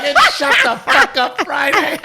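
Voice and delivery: high, loud cheer through laughter; rowdy, gleeful shout; crowd shouting back. Retold or imitated. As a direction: roar it with the whole table. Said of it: "We're like".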